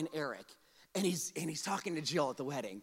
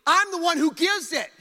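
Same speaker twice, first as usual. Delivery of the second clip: loud, high, emphatic preaching voice; God's declaration; 9 semitones higher, 10 dB louder than usual. Acted. God corrects Moses about his ability, forceful declaration. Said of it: "God is saying".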